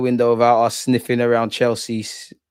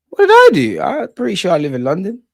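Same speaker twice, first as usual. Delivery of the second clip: sharp, much higher, louder protest; indignant surprise; 7 semitones higher, 3 dB louder than usual. Real, not acted. Streamer protesting a viewer's jab, high outraged yelp. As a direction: yelp it in disbelief. Accused by chat of not being in London.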